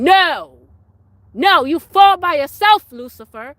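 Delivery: loud, high rebuke; rebuking shout. Real, not acted. Rebuking Lucifer with a loud, high shout. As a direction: cast him down with your voice.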